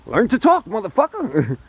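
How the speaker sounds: high, loud call at a clumsy guy; loud mock rebuke to a tongue-tied date; mock yell. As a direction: bark it at the awkward dater. Yelling at a clueless date.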